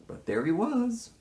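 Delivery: incredulous. Real, not acted. High incredulous remark.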